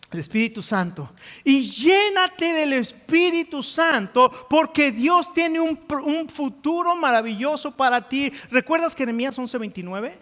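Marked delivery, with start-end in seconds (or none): 1.4-8.3 impassioned preaching. Real, not acted. Exhorts the congregation.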